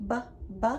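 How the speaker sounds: short "buh" for each "B", not the letter name; letter sound. Shown as a demonstration. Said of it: "what sound does B make?".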